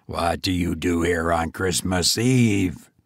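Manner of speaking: low, stern goblin demand. A narrator's reading. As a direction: question him sternly from below. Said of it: "said the goblin sternly".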